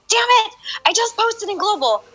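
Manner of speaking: high, exasperated cry of dismay; frustrated outburst. Retold or imitated. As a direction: yelp it like you just messed up. Realizes she posted in global.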